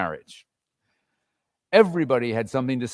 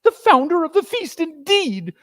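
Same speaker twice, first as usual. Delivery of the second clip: much higher, scornful outburst; indignant cry; 4 dB louder than usual. Acted. Missus Cratchit scoffing at Bob's toast to Scrooge. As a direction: spit the words back at him. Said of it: "cried Missus Cratchit".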